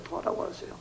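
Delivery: 토하라고 하세요 whispered, no voice; whispered repeat; whispered echo. Shown as a demonstration.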